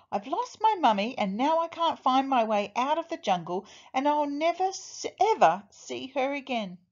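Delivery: mildly tearful acted lament. A narrator's reading. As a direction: lament it in a high, upset voice. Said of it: "Ernest started to cry".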